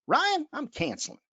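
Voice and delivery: put-on client Jill cancelling in a Southern accent; a lightly Southern Jill, voiced high. Client Jill tells Ryan she's cancelling, Southern accent. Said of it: "Sounded pretty Southern".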